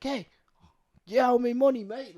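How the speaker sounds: high, loud imitation of Chappie's little accent. Co-host quoting a movie robot's little swears, high mock accent. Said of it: "stuff like that in his little accent".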